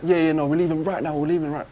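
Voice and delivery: loud, hurried backtracking; sudden switch. Retold or imitated. Caught-out driver telling passenger they're leaving, loud and abrupt. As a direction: imitate a driver suddenly changing his story. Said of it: "he changes instantly".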